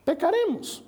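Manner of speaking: high, sly tempting voice on Pecaremos; mock tempter. The false priest tempts Nehemiah, high and sly. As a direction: coax him into sin, mockingly.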